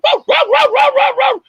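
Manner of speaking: loud, high-pitched German Shepherd barks; imitated dog barking. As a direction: bark frantically like a dog. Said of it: "It was a German Shepherd".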